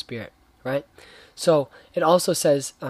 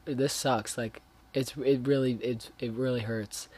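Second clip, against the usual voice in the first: a hushed, much softer echo of bawling; quietly recalling the hurt, half-broken; retold crying; 2 semitones lower, 7 dB quieter than usual. Telling how he cried to his friend Lubby, softly sad. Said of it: "I was, like, crying. I was, like, bawling".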